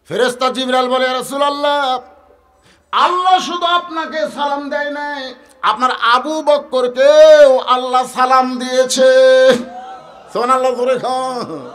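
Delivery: high, loud, ringing sermon delivery; full-force preaching. Acted. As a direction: preach it out high, stretching the key words.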